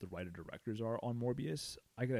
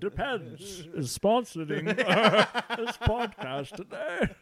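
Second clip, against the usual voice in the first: put-on slow, laughing old man crossed with Bane; old geezer with a Bane rumble; grumpy old man; 12 semitones higher, 12 dB louder, slower than usual. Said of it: "an old man voice going between a cross between a Bane".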